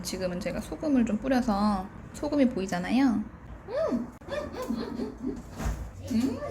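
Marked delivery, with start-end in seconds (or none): 3.9-6.4 high drawn-out yummy hums; savoring hum. Real, not acted. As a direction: hum with delight while chewing. Savoring hot food.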